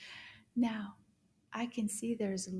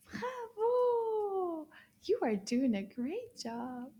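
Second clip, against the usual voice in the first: high, long-held cheer; sing-song high bravo; delighted praise; 8 semitones higher, slower than usual. Real, not acted. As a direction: cheer the learner with a stretched final vowel. Cheering the learner on.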